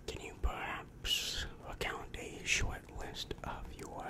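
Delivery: ordinary talk, nothing marked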